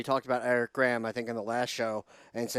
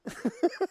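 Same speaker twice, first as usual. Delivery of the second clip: short high-pitched chuckle; laugh. Real, not acted. Co-host amused at feeling guilty, high little laugh. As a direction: let out a quick, high giggle.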